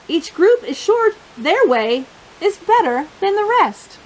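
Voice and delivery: usual speaking voice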